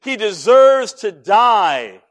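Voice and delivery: loud, slow, forceful condemnation; enraged high priest. Retold or imitated. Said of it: "now enraged".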